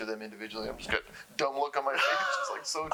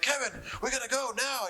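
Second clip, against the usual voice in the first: impatient manager; 13 semitones higher than usual. Retold or imitated. Manager hurrying Kevin, voiced.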